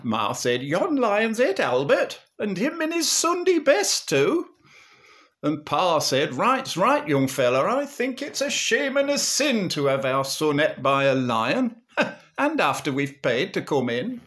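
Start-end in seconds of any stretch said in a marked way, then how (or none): 0.6-4.5 very high, loud Northern mother; hammed-up high-pitched outrage; complaining Ma. Ma complains to the manager. 6.3-14.2 loud, high Northern father laying down the law; comic righteous outrage; indignant Pa. Acted. Pa complains indignantly to manager.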